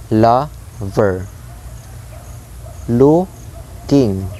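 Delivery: "lover, looking" split, first syllable stressed; high LO and LOO, falling endings in "lover, looking"; trochaic stress. Shown as a demonstration. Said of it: "high stress sound".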